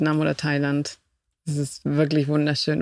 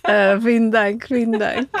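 higher-pitched thanks through laughter; laughing thanks; 7 semitones higher than usual. Real, not acted. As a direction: say thanks while laughing at the grand welcome. Guest laughs after over-the-top welcome. (lachend bedankt)

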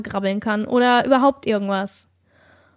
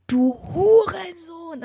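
retold angry yell; 4 semitones higher, much slower than usual. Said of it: "Also so richtig böse".

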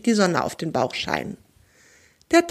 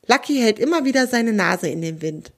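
plain reading voice

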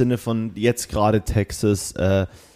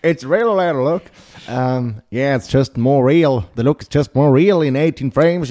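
lightly hammed American English drawl; slow, lightly hammed American accent; american accent in german; 3 semitones higher, 5 dB louder, slower than usual.

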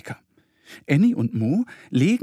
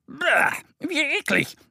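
much higher cry of disgust; disgusted little bear; 14 semitones higher, slower than usual. A narrator's reading. Little bear Mo tastes sage, high disgusted cry. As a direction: spit out the nasty leaf. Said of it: "ruft er".

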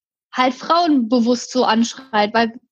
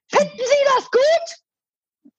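much higher and very slow, sneering; mocking high drawn-out man's voice; 13 semitones higher, much slower than usual. Imitating the angry dog-walker's smug tone to me. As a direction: stretch it out high like a smug scold. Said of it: "Also wirklich in dem Ton".

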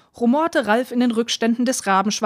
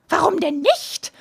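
higher, slow, teasing witch; 7 semitones higher than usual. A narrator's reading. Witch answering the father, higher and teasing. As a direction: toss the question back like a gleeful witch.

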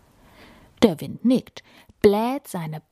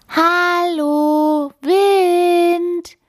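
higher, louder little-snowflake call into the sky; calling loudly; 11 semitones higher, 5 dB louder, much slower than usual. A narrator's reading. Snowflake calls up to the hidden wind, loud. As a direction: shout up to find the wind. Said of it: "ruft sie so laut sie kann".

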